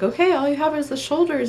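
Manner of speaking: high, bright coaching call; encouraging midwife. Retold or imitated. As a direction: lift it high to urge her on. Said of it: "she was like".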